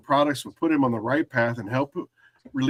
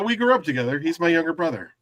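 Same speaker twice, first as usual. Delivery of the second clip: normal talking voice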